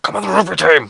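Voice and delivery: high-pitched mock pestering; Mister Frederick badgering. Teacher pushing a boy into rugby, imitated badgering. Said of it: "he was always like, sort of".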